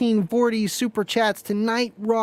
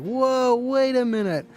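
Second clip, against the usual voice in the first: higher, startled whoa; mock surprise; 5 semitones higher, slower than usual. Donor seeing the wrong amount sent, mock surprised. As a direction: act caught off guard by the extra zero. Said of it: "it's like".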